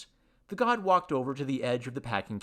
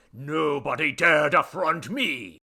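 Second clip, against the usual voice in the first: loud, slow, raised declamation; pompous boastful god; 4 semitones higher, 7 dB louder, slower than usual. Acted. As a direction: proclaim it with towering self-importance. God boasts of his might.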